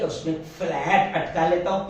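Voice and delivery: ordinary delivery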